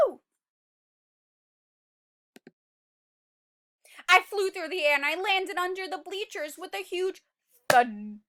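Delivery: lively, loud read-aloud telling; dramatic story narration. A narrator's reading. Teacher reading the seed's escape to children, dramatic narration. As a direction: tell it like an adventure to kids.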